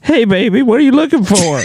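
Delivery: high, hammy put-on voice with a laugh; Mister Haney voice. Man asked to play a streetwalker, hammed up high. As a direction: overdo the come-on in a high silly voice. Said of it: "Mister Haney from Green Acres".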